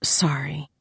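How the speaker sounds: soft, whispered apology. A narrator's reading. Woman regretting asking about his dead parents, whispered. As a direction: whisper it, wincing. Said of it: "I whispered".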